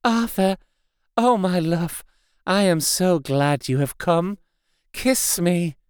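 high, seductive and coaxing, a touch of menace beneath; soft voluptuous voice. A narrator's reading. The changed Lucy tempting Arthur to kiss her, seductive and soft. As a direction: lure him in sweetly. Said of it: "in a soft, voluptuous voice".

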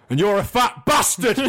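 loud, high mock yell of an insult; hammed-up northern shout. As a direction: bellow the insult in jest. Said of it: "Sean Bean turns to him and says".

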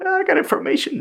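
high-pitched mocking minion; squeaky pleading voice. Mimicking guy in pod.

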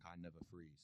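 low, quiet, cool film quote; a soft, lightly voiced movie-hero impression.

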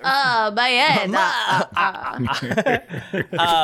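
slow, hammed-up vampire moaning in pain. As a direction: groan theatrically like a wounded cartoon vampire. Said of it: "I just hear [name]'s vampire voice".

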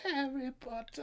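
put-on high, soft British-accented proclamation; hammed-up villain, high and hushed; mock film villain. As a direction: announce it haltingly in a thin high voice. Acting out a film line.

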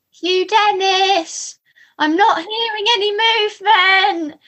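high, drawn-out sing-song call; impression of Claire. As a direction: sing it out like a stern wife calling. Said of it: "you'd hear Claire coming down the road going".

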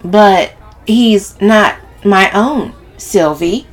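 drawn-out emphatic objection; voice rising. A narrator's reading. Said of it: "her voice rising in kind".

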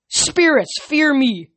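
high, loud war cry; battle cry. Acted. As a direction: roar it to scare the spirits. Goat coach battle cry.

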